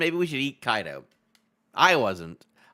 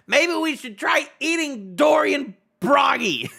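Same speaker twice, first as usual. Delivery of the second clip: slow, much higher and louder proposal; mock eager shout; 10 semitones higher, 6 dB louder, slower than usual. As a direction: announce the silly plan at full volume. Voicing Goldberg's plan.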